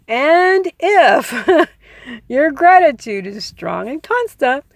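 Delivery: amused giggly restart; laughing through it. Real, not acted. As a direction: laugh at your own stumble as you say it.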